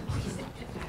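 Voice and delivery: breathless whispered please. Acted. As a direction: beg her under your breath. Pleading under breath.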